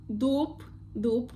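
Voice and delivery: дуб ending in a P, "doop"; voiceless last sound. Shown as a demonstration. Teacher giving an example word, дуб with voiceless ending. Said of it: "always voiceless".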